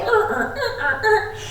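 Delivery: high mock stammer of a confused kid; confused brain. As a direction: play an overwhelmed student's brain. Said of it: "your brain was probably like".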